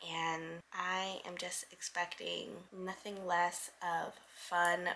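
soft, hoarse early-morning voice. Real, not acted. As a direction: speak with almost no voice left. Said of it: "I have no voice".

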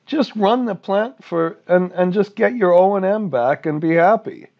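high-pitched, loud mock regulator complaint; indignant regulator. Acted regulator refusing contract extension.

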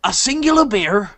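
greasy Hagrid barkeep. Acted. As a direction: haggle like a grubby innkeeper. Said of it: "is this like a greasy Hagrid?".

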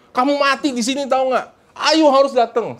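high-pitched, insistent plea; pushy, worked-up insistence; frantic urging. Retold or imitated. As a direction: urge them like an overexcited convert. Acts out urging people to come.

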